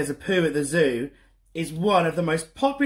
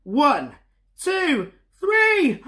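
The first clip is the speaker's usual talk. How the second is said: climbing much higher, ending in a loud shout; bigger building countdown; 10 semitones higher, 4 dB louder, much slower than usual. Shown as a demonstration. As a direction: ramp up to a super loud three. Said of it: "super duper loud".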